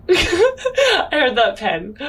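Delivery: breaking into loud laughter mid-line; cracking up, can't hold it; real laughter bursting out; laughing. Real, not acted. Player cracks up at table.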